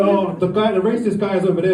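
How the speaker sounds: ordinary delivery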